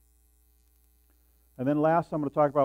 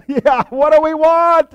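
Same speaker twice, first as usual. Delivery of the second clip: high, loud rally shout; cheerleader yell; 12 semitones higher, 15 dB louder than usual. Retold or imitated. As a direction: whip up the crowd with a shout. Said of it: "So I yell out".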